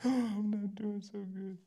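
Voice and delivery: sighing, high, slow confession; sigh, then let it out slowly in a thin voice; acted vulnerable admission, drawn out; reluctant admission. Acted honest admission after brush-off.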